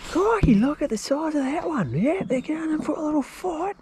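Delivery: high, excited Australian wildlife host; Steve Irwin impression. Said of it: "Give us a Steve Irwin".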